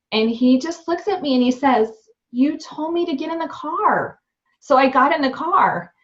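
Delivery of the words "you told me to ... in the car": put-on higher, innocent kid's voice; child answering matter-of-factly; little boy. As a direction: lightly play the innocent little son. Son's reply voiced by mom.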